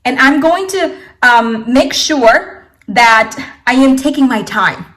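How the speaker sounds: ordinary delivery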